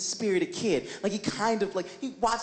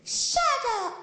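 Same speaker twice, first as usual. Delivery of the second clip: put-on bratty kid yell. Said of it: "And he goes".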